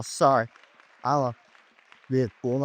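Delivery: usual speaking voice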